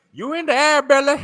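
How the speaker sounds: teasing the man with the stick; mock taunt. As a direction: taunt him like he's flying off. Taunting the stick-wielding homeowner.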